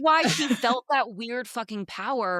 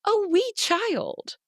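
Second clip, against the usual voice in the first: indignant rant; 9 semitones higher, slower than usual. Retold or imitated. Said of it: "meanwhile, I'm like".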